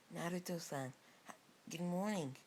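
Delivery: lightly put-on high, coy hello; flirty greeting.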